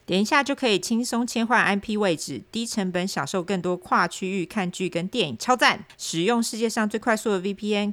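cheerful ad read; infomercial. Acted. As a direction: pitch the product with a smile. Sponsor ad read.

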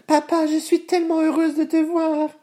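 put-on high, happy little girl cry; joyful exclamation. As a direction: burst out in joy at seeing papa. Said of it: "s'exclama Vassilissa".

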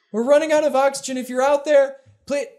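rushed high panicked plea; fast, high, breathless cry for help; running out of air. Acted. Message replayed, faster.

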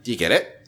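"get it" rising into a question; mock check-in with a climbing "get it"; upward inflection. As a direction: lift "it" at the end as if checking in. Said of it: "they just end with the upward inflection".

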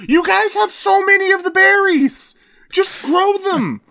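squeaky high cartoon voice; high goofy character voice. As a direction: pitch way up like a silly alien. Dubbing an on-screen character.

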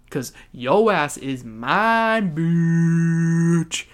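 loud, drawn-out mock boast; slow it down and belt the last word; hammed-up trash talk with a long held "bitch"; domineering taunt. Acted boast to the kid.

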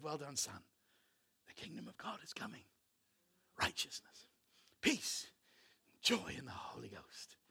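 whispered, soft and intimate; hushed blessing. Retold or imitated. Father whispering praise and promise to his son. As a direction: breathe it like a secret blessing to a son.

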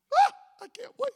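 squealing high, loud "Ah"; excited wife. Retold or imitated. Wife thrilled the new bed is coming, high excited squeal. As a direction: burst with giddy excitement.

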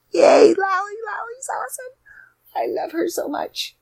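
giddy high-pitched cheer; gushing. Real, not acted. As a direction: squeal with joy about a dear friend. Gushing over her friend.

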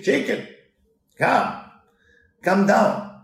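a sweet-talking wolf, loud and high; coaxing wolf. Retold or imitated. Wolf calling the chicken to come down, coaxing.

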